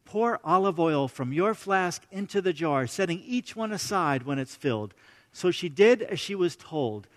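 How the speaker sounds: soft, measured Bible reading; quiet scripture reading voice. A narrator's reading. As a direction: read the passage gently to the congregation.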